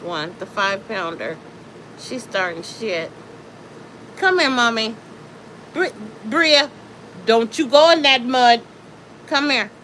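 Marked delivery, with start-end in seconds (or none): 4.2-9.7 high, loud call to a dog. Real, not acted. Owner calls Bria away from the mud, high and loud. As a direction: stop her before the mud.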